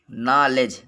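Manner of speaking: नॉलेज said as naaledge, no k sound; silent k in knowledge. Shown as a demonstration. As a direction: say नॉलेज without the k. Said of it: "इसको नॉलेज बोलेंगे". (नॉलेज बिना क के बोलें)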